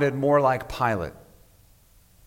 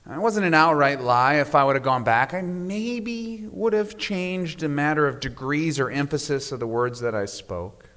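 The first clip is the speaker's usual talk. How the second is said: normal talking voice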